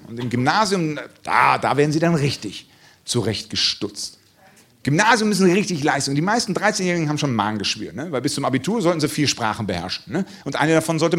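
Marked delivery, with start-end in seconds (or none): none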